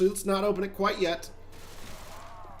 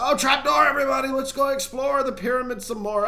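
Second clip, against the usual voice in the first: higher, louder burst of pretend surprise; mock excited cry; 8 semitones higher, 4 dB louder than usual. Player announces a trapdoor to everybody, mock excited cry. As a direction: call out to the whole party, hammed-up. Acts out falling through trapdoor.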